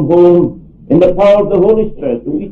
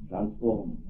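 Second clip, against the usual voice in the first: much softer, lower repeat of Transformed; quiet echo; 9 semitones lower, 18 dB quieter than usual. Real, not acted. Preacher echoing the key word to the congregation, softly. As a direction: breathe the word back softly.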